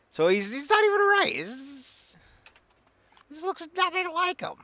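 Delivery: very high strangled voice; squeaky disbelief. Acted. Mocking a wrong photo, high squeaky outrage.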